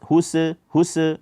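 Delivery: "huse" with an extra uh after the s; wrongly bounced sin. Shown as a demonstration. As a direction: add a stray short vowel to the s in "huse". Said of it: "Sin jangan di, dibaca".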